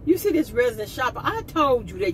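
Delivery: normal talking voice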